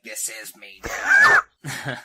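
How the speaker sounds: loud, slow, drawn-out possessed voice; hammed-up menace, stretching the words; mock monster voice. Changed girl to her friend, mock monstrous.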